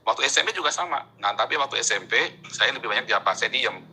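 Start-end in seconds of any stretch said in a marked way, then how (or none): none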